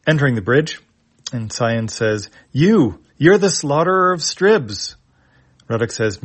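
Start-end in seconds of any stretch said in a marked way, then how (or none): 2.5-4.9 accusing. Acted. Cyan accuses Ruddock.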